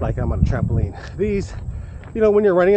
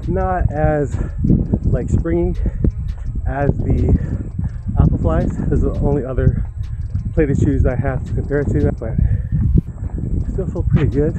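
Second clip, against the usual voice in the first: talking mid-run, louder over the stride; while running; 7 dB louder than usual. Real, not acted. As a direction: speak while jogging on a run. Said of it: "after mile one in the shoe".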